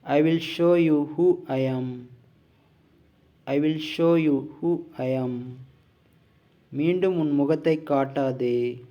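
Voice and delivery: slow, deliberate drill delivery, each word set apart; measured, emphatic classroom read-out; language-lesson model reading. Shown as a demonstration. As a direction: read out each phrase firmly for learners to copy. Reads out model sentences for learners.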